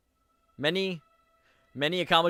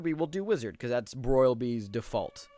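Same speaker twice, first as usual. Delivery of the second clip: ordinary talk, nothing marked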